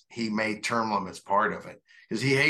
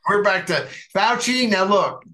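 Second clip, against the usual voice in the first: loud, higher-pitched jokey interjection; joking outburst; 8 semitones higher, 7 dB louder than usual. Real, not acted. Co-host cuts in on a segue about hitting people, joking outburst. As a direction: jump in loudly, pitch up, teasing.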